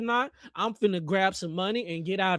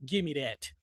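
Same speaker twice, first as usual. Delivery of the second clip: ordinary talk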